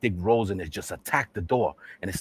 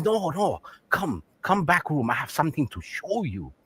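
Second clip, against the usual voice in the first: put-on raised-pitch caricatured Chinese accent; mock Chinese accent; 6 semitones higher, 3 dB quieter than usual. Shopkeeper greets a customer, mock Chinese accent. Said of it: "this Chinese man comes out".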